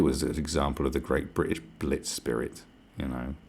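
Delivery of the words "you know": ordinary talk, nothing marked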